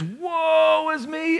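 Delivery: high, slow, drawn-out cry of woe; stretched, high lament; Isaiah's lament. Acted. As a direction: wail it out as a man undone before God. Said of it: "He says".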